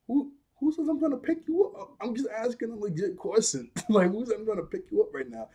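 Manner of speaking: high, squeaky protest; mock defensive. Voicing himself protesting to the girl, high and squeaky. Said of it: "I was like".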